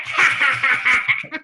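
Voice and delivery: slow, separate cackling ha-ha-ha; imitated cackle. Medium showing how client's grandma laughed, imitated cackle. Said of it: "She cackled".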